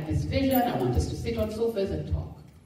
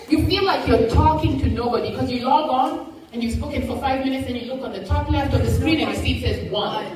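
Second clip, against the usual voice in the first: excited laughing telling; 6 semitones higher, 7 dB louder than usual. Real, not acted. Laughing story to excited crowd.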